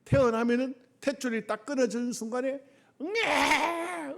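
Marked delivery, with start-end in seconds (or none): 3.0-4.1 imitated baby cry, drawn out and high; mock infant "waah"; newborn's wail. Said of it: "응애 울어요".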